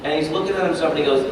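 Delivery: ordinary talk, nothing marked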